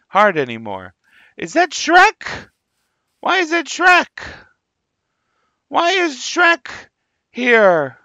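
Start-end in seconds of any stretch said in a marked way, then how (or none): 1.4-8.0 surprised. Real, not acted. Sees Shrek in the game.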